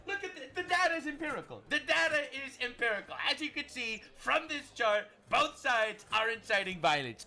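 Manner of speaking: lightly voiced smug pundit, pitched high; mock liberal voice. Mimicking critics citing their chart. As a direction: put on a high know-it-all tone. Mocking opponents' argument.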